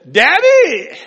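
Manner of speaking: high, loud cry of Daddy; loud high-pitched call of Daddy; shouted Daddy. Retold or imitated. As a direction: belt out Daddy like a child calling. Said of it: "he got up and he said".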